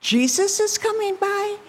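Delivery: high-pitched, astonished question; amazed question. Retold or imitated. Blind man hearing who is passing, amazed. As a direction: echo the news high, in disbelief.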